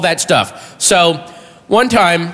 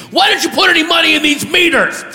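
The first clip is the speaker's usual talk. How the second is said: high, shouted demand; furious scream; 11 semitones higher than usual. Retold or imitated. Furious parking officer to driver, high angry scream. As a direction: yell it accusingly at high pitch. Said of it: "he screams to me".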